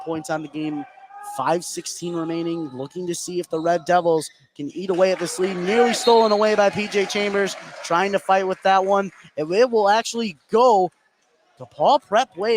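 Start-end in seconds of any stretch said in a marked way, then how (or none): 5.6-12.5 higher, louder scramble call; excited call. Real, not acted. Caller following a scramble for the ball, excited. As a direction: chase the loose ball with your voice. Live basketball play-by-play.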